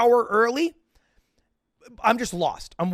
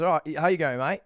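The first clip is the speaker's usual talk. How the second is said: chirpy mock Australian accent, slightly lower; cheerful Australian; 4 semitones lower than usual. Queue-jumper greets the man he cut, cheerful Australian accent. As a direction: imitate a breezy Australian bloke. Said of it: "in a very cheerful Australian accent".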